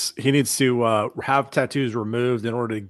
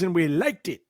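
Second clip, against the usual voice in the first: put-on old man voice; 4 semitones higher than usual. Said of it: "I know I sound like a very old man right now".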